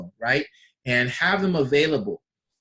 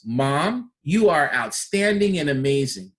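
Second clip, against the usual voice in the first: ordinary talk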